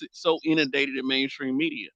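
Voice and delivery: usual speaking voice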